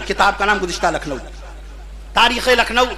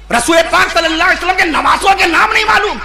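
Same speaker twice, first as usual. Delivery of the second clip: raised, impassioned sermon voice; fiery preaching; 5 semitones higher than usual. Acted. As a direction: preach it hard to the crowd.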